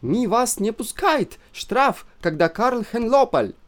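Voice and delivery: put-on high, slow broken Russian in a German accent; a spiteful German housewife. A narrator's reading. German wife refuses them, in a high German accent.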